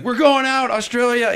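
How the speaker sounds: high, loud party cry; rally your buddy for a big trip; excited announcement. Retold or imitated. Reliving the Australia trip with his buddy, excited announcement.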